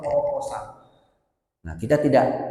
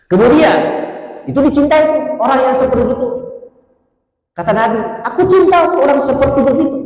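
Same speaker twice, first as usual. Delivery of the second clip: higher, louder fiery sermon voice; full-force preaching; 11 semitones higher, 10 dB louder than usual. Acted. Preacher rouses the congregation about helping others, full force. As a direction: proclaim it like a rousing preacher.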